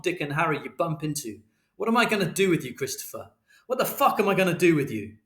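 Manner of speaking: furious tirade. A narrator's reading. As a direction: rage at him without letting up. Father's furious tirade at son.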